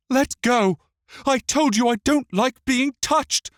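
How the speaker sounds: put-on high-pitched, loud scream in a child's voice; screaming boy. A narrator's reading. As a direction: shriek it high as a boy struggling to pull free. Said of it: "Simon screamed".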